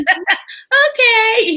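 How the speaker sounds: high, loud, laughing okay. Retold or imitated. Mother voicing her son agreeing, laughing. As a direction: give in with a laugh.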